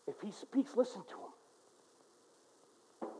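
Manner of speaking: soft, slow whispered plea; a preacher whispering for weight; hushed whisper. Real, not acted. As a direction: draw them in, barely a whisper. Sermon point dropped to hush.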